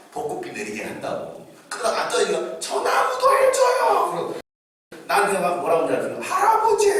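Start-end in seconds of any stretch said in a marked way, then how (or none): 2.6-4.1 much higher, louder, slow and laughing; a child yelling for another tree, laughing; excited child shout. Retold or imitated. 6.2-6.9 much higher and louder; a five-year-old calling the pastor grandpa; child calling out. Retold or imitated.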